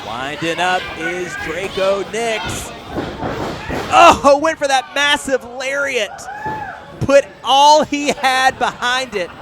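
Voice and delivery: excited ringside call; wrestling commentator. Real, not acted. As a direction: call each big move loud. Live wrestling play-by-play, big spots.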